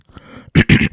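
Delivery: sharp throat-clearing cough. Real, not acted. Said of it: "Excuse me".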